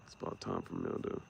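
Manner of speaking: whispered aside under the breath; barely voiced, like a secret. Real, not acted. Unvoiced aside.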